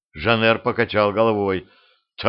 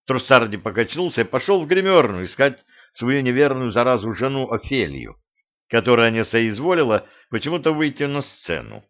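usual speaking voice

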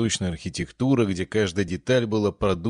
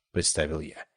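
plain reading voice

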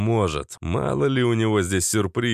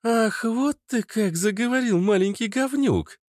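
raised, hammed-up outrage from a girl; high mock-offended female voice; 13 semitones higher than usual. A narrator's reading. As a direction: play-act being insulted, high. Natasha mock-offended at gnome.

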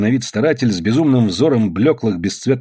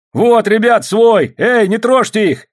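loud, high-pitched call to comrades; 10 semitones higher, 4 dB louder, slower than usual. A narrator's reading. Soldier calling comrades off the men, loud and high. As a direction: call them off with a raised voice.

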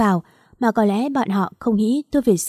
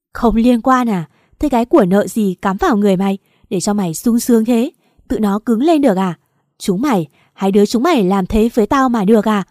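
louder scornful yell; furious shout; 5 dB louder than usual. Acted. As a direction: spit the question back at her. Wife raging at both.

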